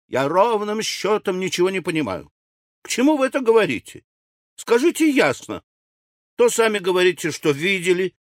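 high-pitched exasperated demands; возмущённая вдова. A narrator's reading. Widow demands a clear explanation. (возмущённо, высоким голосом)

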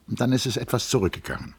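fast, eager comeback; quick retort. A narrator's reading. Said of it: "versetzte Hans Castorp rasch". (rasch und eifrig, schnell gesprochen)

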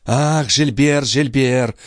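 bitter muttering. A narrator's reading. Brother laments the escaped enemy's name, high drawn-out muttering. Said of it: "бормотал Филипп".

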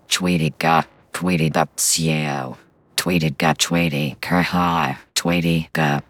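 loud, cute Pokemon calling its name. Shown as a demonstration. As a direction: squeak out a little Pokemon cry. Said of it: "I can kind of do some Pokemon voices".

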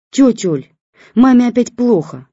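high, loud, dramatic plea; tragic. A narrator's reading. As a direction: sound tragic. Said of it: "трагично сказала Лиза".

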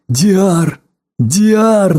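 high, building delight; jubilant exclamation. A narrator's reading. Friends rejoice at the heart speaking. (радостно, всё выше)

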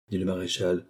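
plain reading voice

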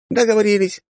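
put-on high, delighted kid cry; joyful exclamation. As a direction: seal the deal gleefully. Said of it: "радостно воскликнул малец".

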